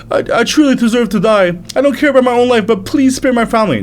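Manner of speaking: grovel in fear for your life; terrified servant. Acted. Servant begs the angry prince, terrified high pleading.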